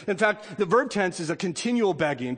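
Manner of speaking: ordinary delivery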